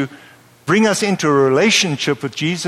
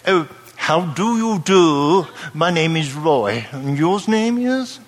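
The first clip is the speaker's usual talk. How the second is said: British greeting; slower than usual. Retold or imitated. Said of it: "In Britain, when you meet somebody".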